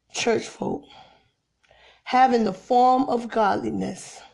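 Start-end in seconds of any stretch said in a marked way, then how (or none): 0.1-0.8 hushed, pointed whisper. Acted. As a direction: drop to a breathy whisper on church folk. Sermon refrain trails into whisper.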